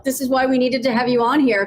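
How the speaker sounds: normal talking voice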